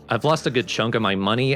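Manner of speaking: ordinary delivery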